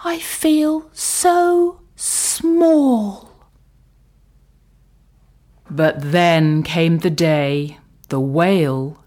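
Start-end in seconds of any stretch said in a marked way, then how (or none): 0.0-3.3 tiny snail voice. Acted. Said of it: "she said to the whale".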